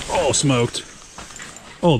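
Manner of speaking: ordinary talk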